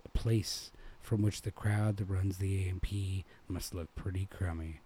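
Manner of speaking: plain reading voice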